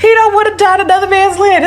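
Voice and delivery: excited retelling. Retold or imitated. She repeats boyfriend's beach line.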